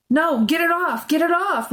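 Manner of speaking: high, panicked cries; frantic high-pitched squeal of alarm; shrieking. A narrator's reading. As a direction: shriek in revulsion. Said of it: "Renee shrieked".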